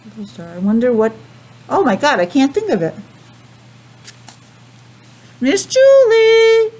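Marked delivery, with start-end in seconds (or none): 5.4-6.7 loud, high, drawn-out call; yelling for help. Real, not acted. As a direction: call across the house. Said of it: "That's why I yelled".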